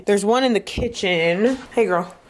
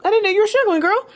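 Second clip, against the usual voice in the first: much higher, sweet voice talking to a plant; cooing at plant; 13 semitones higher, 3 dB louder, faster than usual. Retold or imitated. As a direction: coo to the plant like a pet. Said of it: "I'm like".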